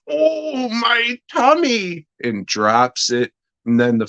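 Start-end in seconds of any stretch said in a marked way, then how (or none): 0.1-2.1 very high, slow whiny granny voice; mock old lady groan. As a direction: whimper it like a feeble old woman.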